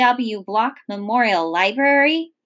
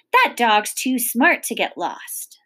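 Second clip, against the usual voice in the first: ordinary delivery, nothing marked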